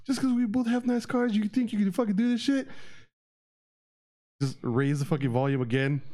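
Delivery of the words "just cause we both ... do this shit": high-pitched retelling of an indignant rant; mock angry scream. Screaming driver confronts him in the parking lot, mock outrage. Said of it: "started fucking screaming".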